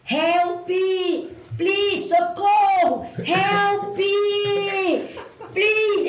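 high, drawn-out cries for help; reenacted yell across a crowd; shouting for attention. Runner shouting to catch the prince's attention, reenacted yell. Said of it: "Agora eu vou ter que gritar".

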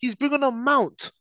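slow, high disbelief; incredulous. Real, not acted. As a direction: say it like you can't believe it. Disbelief at a substitution.

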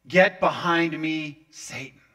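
slow, weighty reading, dropping on the name; grave rebuke. Acted. Preacher reading what is almost hard to read, grave rebuke. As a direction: pronounce it heavily, last word hushed. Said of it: "it's almost hard to even read".

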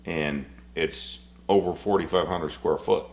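normal talking voice

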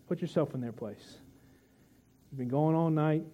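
usual speaking voice

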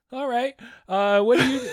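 high, loud stalling; mock flustered comic. Imagined comic stumped by janitor, flustered mock stall. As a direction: stall nervously with nothing to say.

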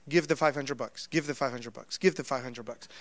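low, hushed repeated prompting; soft, low and insistent, like a thought that won't quit; inner voice. Retold or imitated. Voice in his spirit prompting him to give, hushed. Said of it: "all I kept hearing in my spirit".

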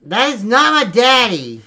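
loud indignant denial; offended shout; angry outburst. Acted. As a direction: snap back hard at the insult.

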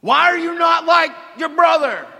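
loud, high furious shout; full mock parental shout; angry yell. Parent yelling at child, voiced.

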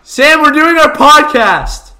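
loud high shout; calling out. Real, not acted. Host to Sam interrupting the podcast, exasperated loud yell. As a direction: yell it across to someone off-mic. Yells to someone outside the room.

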